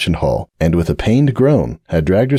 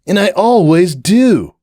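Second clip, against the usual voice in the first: slow, louder, higher cocky insistence; insisting; 11 semitones higher, 3 dB louder, slower than usual. Acted. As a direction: stretch it out, sure of himself. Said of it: "Hoops insisted".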